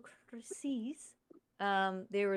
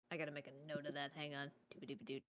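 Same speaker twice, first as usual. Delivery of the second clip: muttering to self; 6 semitones lower, 13 dB quieter, faster than usual. Real, not acted. Quietly muttering while writing a note.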